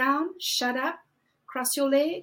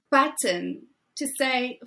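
ordinary talk

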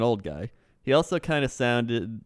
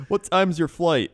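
higher, louder put-on Canadian accent; comic Canadian accent; 7 semitones higher, 5 dB louder than usual. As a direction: ask it as a chatty driver with a Canadian accent. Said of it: "need you to use that accent".

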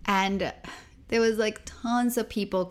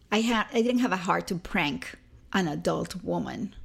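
ordinary talk, nothing marked

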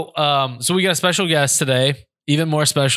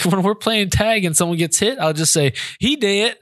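higher-pitched imitation of a child explaining; lightly voiced daughter; 7 semitones higher than usual. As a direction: lightly mimic a daughter telling her troubles. Dad voices his upset daughter.

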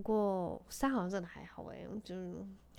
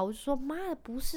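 usual speaking voice

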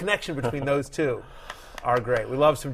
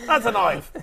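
punchy mock Aussie, stress on That's; higher mock Australian payoff line; quick confident fake Aussie delivery; Australian accent; 7 semitones higher than usual. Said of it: "Trying to think Australian".

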